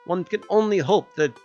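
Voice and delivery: ornate, lilting put-on accent for a formal letter; [name]'s silly accent. [name] writing tenderly to Jay, formal put-on accent. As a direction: read it in a florid comic accent. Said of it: "My wife is laughing at my accent".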